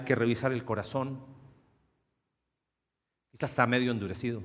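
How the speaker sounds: soft, low voice with long pauses; hushed, unhurried pastoral appeal, falling at each phrase end; prayerful reflection. Real, not acted. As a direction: gently lead listeners into prayer.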